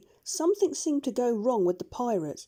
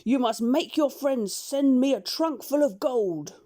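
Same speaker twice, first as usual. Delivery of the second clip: loud, higher, mocking pirate captain voice; gloating pirate shout; 4 semitones higher, 5 dB louder than usual. A narrator's reading. Pirate chief shouting across the water at the doctor, gloating. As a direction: crow over the caught doctor like a pirate chief. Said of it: "shook his fist at the doctor and shouted".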